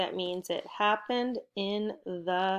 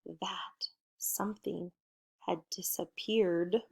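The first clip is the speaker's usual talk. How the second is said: whisper; 6 dB quieter than usual. Acted. Teacher whispers story for suspense.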